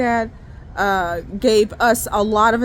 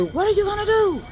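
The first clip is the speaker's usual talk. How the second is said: high-pitched, imitated preacher's challenge; stern; 10 semitones higher than usual. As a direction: confront the congregation like a stern preacher. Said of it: "he said sternly".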